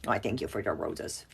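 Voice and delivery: low, soft mock-theatrical thanks; hammed-up diva after applause, but quiet; mock stage bow. Teacher pretends to take a bow for solving the problem, soft and low. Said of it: "I don't know why I said it like that".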